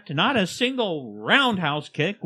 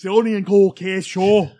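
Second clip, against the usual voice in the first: loud, higher Scottish show-host voice; hammed-up Scottish intro; 4 semitones higher, 4 dB louder than usual. Scottish podcaster proudly introducing his Oklahoma show, big Scottish brogue. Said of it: "Please intro the show like that".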